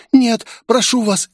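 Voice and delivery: high, squeaking terrified plea; frightened squeak. A narrator's reading. Young woman singled out as hostage, squeaking in terror. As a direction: squeak out a desperate no. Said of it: "испуганно пискнула она".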